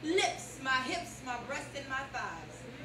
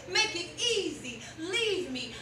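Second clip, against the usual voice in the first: normal talking voice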